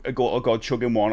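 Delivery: usual speaking voice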